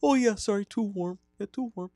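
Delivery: very high, creepy Wisconsin voice; chirpy high killer voice, staying in character; Ed Gein character. Acted. Host staying in his killer character for the cohosts.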